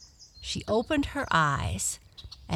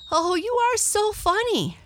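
very high, delighted character voice; amused Tearful; 5 dB louder than usual. Acted. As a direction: exclaim in high-pitched amusement. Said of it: "Tearful laughed again".

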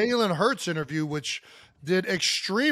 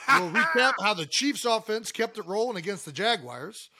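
usual speaking voice

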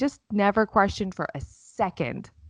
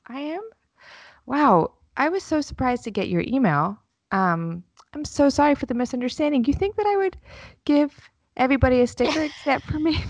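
high, sweet put-on teacher voice; lightly mocking a sweet phone voice; teacher's voice; 8 semitones higher than usual. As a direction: play the overly nice teacher, pitched up. Teacher voiced in retold call.

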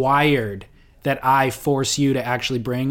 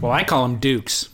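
ordinary talk, nothing marked

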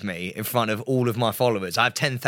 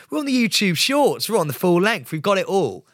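car salesman; 6 semitones higher than usual. Acted. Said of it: "you sound like you said in a car".

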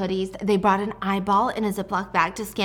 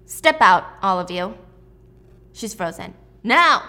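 barked order; 6 dB louder than usual. Retold or imitated. Gunman orders girls out.

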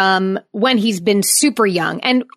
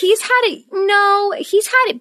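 high playful voice; 11 semitones higher than usual. Real, not acted.

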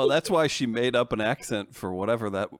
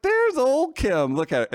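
1 proud mock cheer; 13 semitones higher, 3 dB louder than usual. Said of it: "And I was like".

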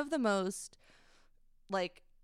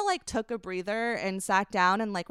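normal talking voice

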